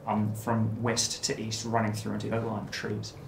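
ordinary delivery

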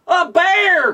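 high alarmed outcry at a bear; alarmed cry. Acted. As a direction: cry out in fright, pitched high. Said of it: "cried the man".

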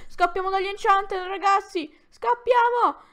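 calling the guys to run for it; panicked shout. Real, not acted. Telling the guys to flee the Enchanted, a rising panicked shout. Fleeing enemies in game.

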